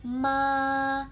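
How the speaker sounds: MA high, long and level; "ma" sustained without any pitch change; level first tone. Shown as a demonstration. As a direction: hold MA on one straight high note. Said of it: "nada satu".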